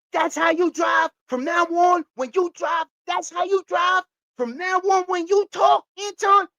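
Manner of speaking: high-pitched, insistent movie-quote voice; Bad Boys movie impression. Quoting Bad Boys at Anton, high insistent impression. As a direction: lay down the law in a high, hyped voice. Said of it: "Just like in Bad Boys".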